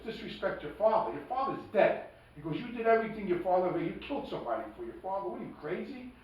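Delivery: imitating a friend's exasperated scolding; retold scolding. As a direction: fire back at him, exasperated.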